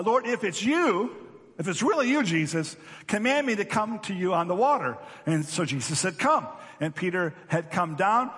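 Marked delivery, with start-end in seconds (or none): 0.0-5.0 Peter calling out. Retold or imitated. Peter calling out from the boat, raised voice. 6.2-6.5 a high, loud single call; Jesus calling. Retold or imitated. Jesus calling Peter onto the water, loud.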